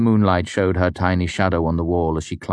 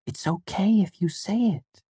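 gentle. A narrator's reading. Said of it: "Auri said softly".

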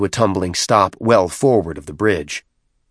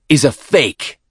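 scornful warrior; 6 semitones higher, 5 dB louder, slower than usual. Acted. Tartarus smashes the projector.

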